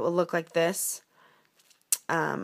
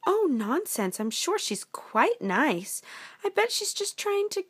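breezy princess voice, climbing high; trusting princess; 11 semitones higher than usual. Acted. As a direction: brush off the worry in a princess voice. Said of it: "she said".

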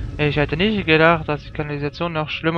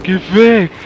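mock yell, loud and a little higher; imitated shout; 4 semitones higher, 11 dB louder than usual. Player imitates the attacker coming at him, mock shouting. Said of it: "Kommt er auf mich zu und schreit".

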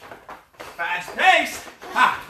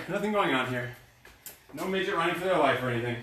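much softer and lower, hushed; hushed joke; 7 semitones lower, 8 dB quieter, faster than usual. Real, not acted. Coach covering for a distraction, hushed and low. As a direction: play it innocent under your breath.

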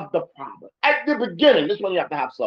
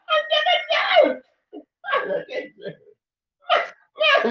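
mock outburst; much slower than usual. Shown as a demonstration. Anger bursting out.

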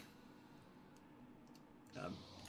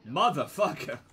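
loud frustrated curse; angry shout; 5 semitones higher, 12 dB louder than usual. Real, not acted. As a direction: yell the curse.

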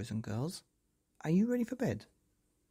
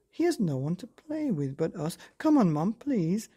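put-on higher, louder begging child; pleading; 5 semitones higher, 5 dB louder than usual. A narrator's reading. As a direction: wheedle mum, pushing harder at the end. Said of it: "pleaded Hal".

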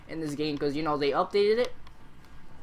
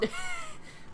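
quick high laugh; 7 dB quieter than usual. Real, not acted. Laughing mid-sentence.

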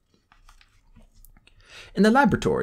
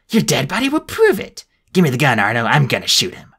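furious shout; 3 semitones higher, 4 dB louder than usual. Acted. Spiro raging with gun.

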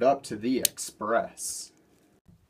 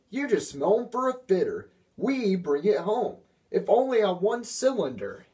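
higher, scornful engine telling off the diesels; scornful Duck; 9 semitones higher than usual. A narrator's reading. Duck scolds the boastful diesels, higher and scornful. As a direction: sneer at them as frauds.

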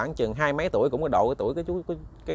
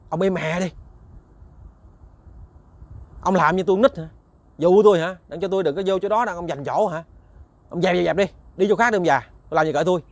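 insolent shouting; 4 semitones higher than usual. Acted. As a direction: sneer and shout him away. Young man insults old man. (giọng hỗn láo, quát tháo)

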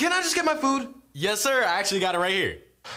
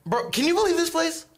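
high incredulous; 11 semitones higher than usual. Acted. Customer venting to other diner.

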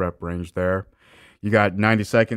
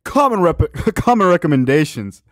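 mock quote; 8 semitones higher, 7 dB louder than usual. Mocking the video's phrase.

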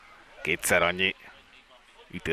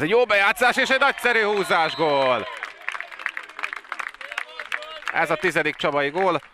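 excited hungarian sports commentary; 10 semitones higher than usual. Real, not acted. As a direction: erupt as the goal goes in. Goal call in water polo.

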